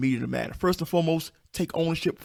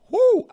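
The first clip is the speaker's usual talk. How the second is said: high, loud "woo!"; sudden high-pitched whoop; excited whoop; 10 dB louder than usual. Real, not acted. Podcaster thrilled by his own line on perfection, excited whoop.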